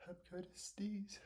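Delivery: soft breathy whisper. Real, not acted. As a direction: whisper it under your breath. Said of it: "said twice, the second time whispered".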